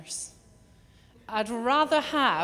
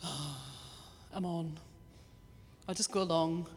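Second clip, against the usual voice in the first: much lower and softer, deflated and unwilling; reluctant sigh; 5 semitones lower, 8 dB quieter than usual. Retold or imitated. Preacher voicing a churchgoer reading the rota, deflated and reluctant. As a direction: sound let down at seeing your name on the rota. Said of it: "you look in the rota and you go".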